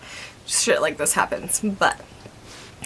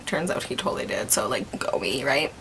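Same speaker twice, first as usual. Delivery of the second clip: whispering; 7 semitones lower, 7 dB quieter than usual. Real, not acted. Whispered aside about being right.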